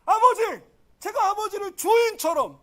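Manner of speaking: high, loud, heated protest; protesting elder son. Acted. Elder son complaining about slaving for father, indignant. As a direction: cry out your grievance to your father. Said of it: "큰아들이 뭐래요".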